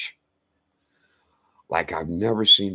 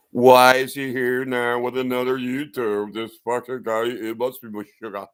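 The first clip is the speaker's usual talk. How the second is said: mock critic; 4 semitones higher, 5 dB louder than usual. Mocking viewers' reaction to him.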